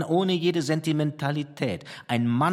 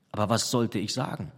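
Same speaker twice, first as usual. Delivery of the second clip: plain reading voice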